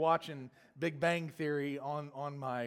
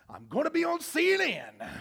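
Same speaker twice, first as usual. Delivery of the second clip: much higher, louder, gleeful squeal; excited; 13 semitones higher, 7 dB louder than usual. Retold or imitated. Passenger thrilled by a fight nearby, high gleeful exclamation. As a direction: squeal it like you're about to be famous.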